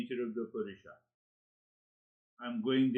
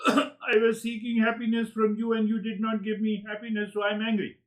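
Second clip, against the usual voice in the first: complaining angry person; 7 semitones higher, 5 dB louder than usual. Retold or imitated.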